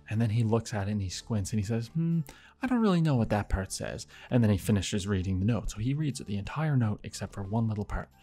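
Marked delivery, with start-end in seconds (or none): 1.9-4.0 mock puzzlement. Imitates neighbour squinting at note.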